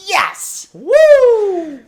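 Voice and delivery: very loud, high victory whoop; real cheering. Real, not acted. Co-host cheering the receipt payoff, elated. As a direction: cheer the punchline at full volume.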